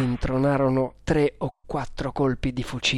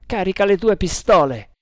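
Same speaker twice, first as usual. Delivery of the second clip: higher, louder command; urgent order; 6 semitones higher, 6 dB louder than usual. A narrator's reading. Tremal Naik readies Nagor for attack, ordering urgently. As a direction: give the order loud and raised in pitch.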